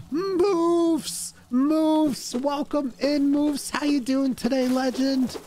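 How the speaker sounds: very high, singsong welcome; gushing high-pitched hello; excited greeting. Real, not acted. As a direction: squeal hello to a favourite regular. Greeting an arriving viewer in chat.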